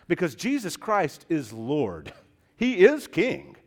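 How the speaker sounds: forceful, ringing sermon climax; emphatic preaching. Real, not acted. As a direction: proclaim it from the pulpit.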